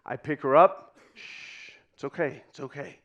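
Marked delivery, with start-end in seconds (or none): 1.1-3.0 hushed soothing. Acted. Nine-year-old comforting his crying baby sister, hushed. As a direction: gently hush a crying baby.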